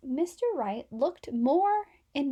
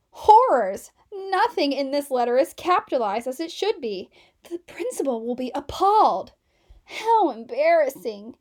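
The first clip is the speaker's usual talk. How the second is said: loud shocked cry; hammed-up alarm, louder; horrified outburst; 7 dB louder than usual. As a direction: gasp in horror, loudly.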